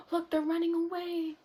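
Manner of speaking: ordinary delivery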